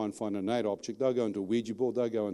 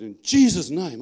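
sudden loud, raised-pitch invocation; commanding cry; 5 semitones higher, 9 dB louder, slower than usual. Retold or imitated. Man lifting his hand against a possessed woman, crying out in command. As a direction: call it out forcefully, like casting out a demon. Retells invoking Jesus against demon.

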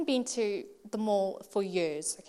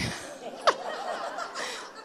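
very high-pitched laugh; real giggling fit at own story. Real, not acted. Laughs before giving the point.

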